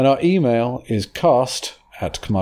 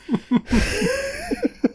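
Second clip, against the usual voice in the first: high squealing laugh; 4 dB quieter than usual. Real, not acted. Laughs after the father joke.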